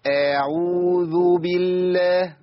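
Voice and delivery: أعوذ بالله with a long vowel added after the hamza; over-lengthened vowels. Shown as a demonstration. Teacher mimics a learner's error, dragged hamza in أعوذ. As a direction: stretch the first vowel of أعوذ very slowly. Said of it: "بيعمل حرف مد بعد الهمزة".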